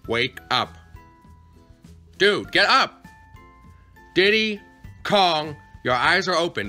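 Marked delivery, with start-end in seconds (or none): 2.2-3.0 loud frustrated yell; shouting. Acted. Losing patience waking kid. 4.1-6.7 loud exasperated call. Acted. Annoyed calling the kid.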